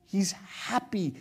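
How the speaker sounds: slow, savoring each word; slow tender emphasis. Real, not acted. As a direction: draw it out slowly to let it land.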